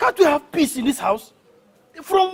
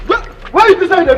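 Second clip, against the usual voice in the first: excited shout; 5 semitones higher, 7 dB louder, faster than usual. Acted. Chasing the intruder.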